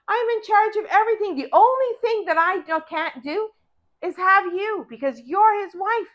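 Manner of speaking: high, loud and firm refusal; Joseph refusing. Acted. Joseph refuses master's wife.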